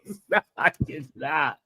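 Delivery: ordinary delivery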